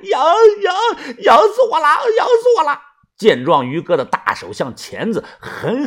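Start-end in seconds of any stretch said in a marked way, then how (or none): 0.1-2.8 much higher, loud desperate cries; shrill frantic yells; screaming. A narrator's reading. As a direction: howl in unbearable itching. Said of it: "口中大喊".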